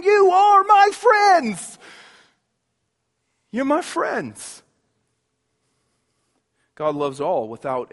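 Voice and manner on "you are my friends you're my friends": Jesus calling out. Acted. Jesus voiced welcoming sinners.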